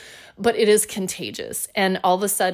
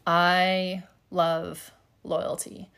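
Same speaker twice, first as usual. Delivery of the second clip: ordinary delivery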